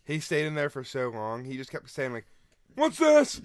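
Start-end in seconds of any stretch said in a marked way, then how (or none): 2.8-3.4 very high, loud mocking voice; high-pitched dim pestering customer; slow customer impression. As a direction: imitate a clueless customer way up high. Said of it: "I'm glad your impression".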